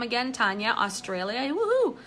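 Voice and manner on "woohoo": high, loud woohoo; excited cheer. Real, not acted. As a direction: cheer her on, much higher. Cheering a viewer from Australia.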